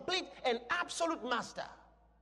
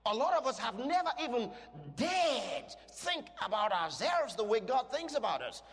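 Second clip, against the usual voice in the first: ordinary delivery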